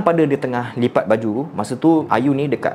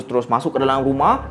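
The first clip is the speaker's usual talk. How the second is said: usual speaking voice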